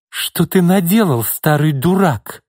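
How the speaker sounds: angry exclamation. Acted. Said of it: "злобно воскликнула она".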